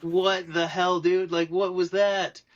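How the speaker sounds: high, loud, slow outraged demand; drawn out in disbelief; indignant yell. Retold or imitated. Confronting driver after being hit.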